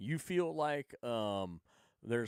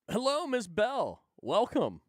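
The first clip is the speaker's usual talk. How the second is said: higher, louder cheery greeting; calling out a welcome; 9 semitones higher, 6 dB louder than usual. Real, not acted. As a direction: hail her as she joins. Greeting arriving guest.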